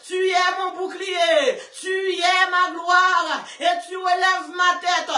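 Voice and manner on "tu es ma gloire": ordinary talk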